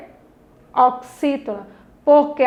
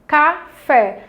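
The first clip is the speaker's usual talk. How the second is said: "Café" as two detached beats; deliberate classroom split of "Café"; separated syllables; 2 semitones higher than usual. Shown as a demonstration. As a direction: pause between ca and fé in "Café". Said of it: "a senhora tá falando separando".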